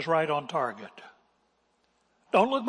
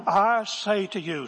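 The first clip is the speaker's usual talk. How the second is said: loud, raised, drawn-out pulpit address; W A Criswell; 6 semitones higher, 6 dB louder, slower than usual. Retold or imitated. Preacher trying to capture his pastor's voice, imitated pulpit oratory. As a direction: imitate a grand old-style orator, stretching each word. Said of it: "oh, I wish I could capture that voice".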